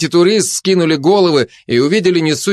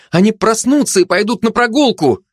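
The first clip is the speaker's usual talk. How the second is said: high-pitched cry of worry; panicked; 10 semitones higher than usual. A narrator's reading. Mother fears her pups will wander out, panicked. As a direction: protest in rising panic. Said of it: "вскричала в панике мать".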